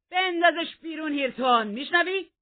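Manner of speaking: high furious shout; roared order. A narrator's reading. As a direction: yell the command at full force. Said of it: "هیتکلیف قرید".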